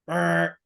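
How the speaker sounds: sustained vocal buzz; imitated door buzzer. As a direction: buzz like an intercom door release.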